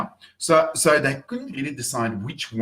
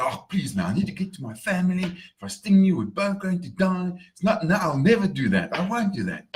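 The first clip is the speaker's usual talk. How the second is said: higher-pitched, insistent pleading; acted plea, pitched up; pleading scorpion; 7 semitones higher than usual. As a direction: beg and promise to be trusted. Scorpion pleads, promises in story.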